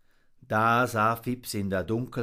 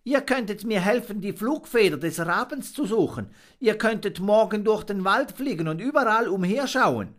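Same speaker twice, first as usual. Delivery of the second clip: higher, quick, eager plea; eager monkey; 10 semitones higher, 5 dB louder, faster than usual. Acted. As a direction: rush out the plan hopefully. Fips keeps pleading.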